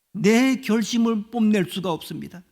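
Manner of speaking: ordinary delivery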